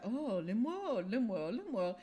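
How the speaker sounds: slow "Lim World", L for DR; lightly imitated Thai driver saying "Lim World"; mimicking the driver. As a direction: drawl out "Lim World" as a question. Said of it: "He kept saying it, like".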